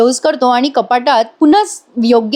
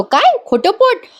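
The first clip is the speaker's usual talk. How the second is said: alarmed; 11 semitones higher, slower than usual. Retold or imitated. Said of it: "जीवा घाबरतो".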